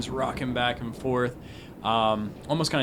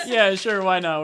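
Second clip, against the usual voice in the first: laughing; 10 semitones higher, 8 dB louder than usual. Real, not acted.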